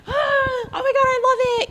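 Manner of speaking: shrieked very high "oh my God, I love it"; gushing. Retold or imitated. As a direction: squeal like a thrilled fan. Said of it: "kind of person".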